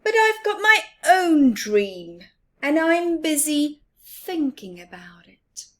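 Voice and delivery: lost in his own dream; Little Mouse dreamy. Acted. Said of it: "said Little Mouse".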